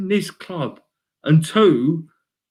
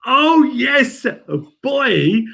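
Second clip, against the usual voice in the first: excited cheer; 8 semitones higher, much slower than usual. Real, not acted. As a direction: greet it like a win. Excited reaction to comment.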